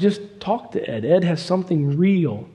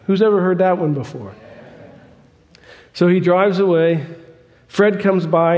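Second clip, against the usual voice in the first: ordinary talk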